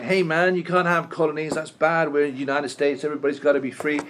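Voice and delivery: a jokey, deliberately bad American accent for the idealist president; Woodrow Wilson in a terrible American accent. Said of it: "It's a terrible American accent".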